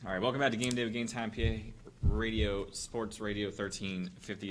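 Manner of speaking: upbeat on-air welcome; radio host intro. Acted. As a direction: announce the segment back from break. Radio show segment intro.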